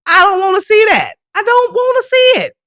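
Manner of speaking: exasperated high protest. Real, not acted. Telling dick pic senders off, high and loud. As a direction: pitch it up in disgust.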